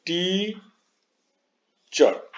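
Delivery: Teacher drawn out as two separate beats, टी held long and high, then चर; split into syllables. Shown as a demonstration. Teacher showing pupils two syllables, split and drawn out. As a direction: say teacher slowly, broken into टी and चर. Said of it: "दो बार में हमने उच्चारण किया".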